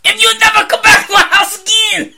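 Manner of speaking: high, loud yelled mock Chinese accent; over-the-top angry Chinese-accent caricature; mock Chinese accent impression. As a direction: shout it in a hammed-up Chinese accent. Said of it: "do it as an impression".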